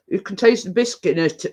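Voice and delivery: talking while chewing; words round a mouthful of truffle; mouth full. Real, not acted. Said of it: "Talking with my mouth full again".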